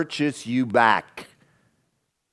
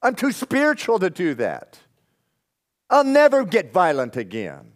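higher and louder, smug caricature; mock boastful vows; 7 semitones higher, 3 dB louder than usual. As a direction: ham up the overconfident believer. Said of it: "You know what that sounds like?".

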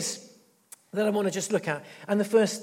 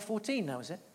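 normal talking voice

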